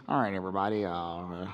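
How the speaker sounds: low, muffled captain on the intercom; pilot voice. Retold or imitated. Preacher mimics the pilot to the congregation, low intercom mumble. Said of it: "you have the captain".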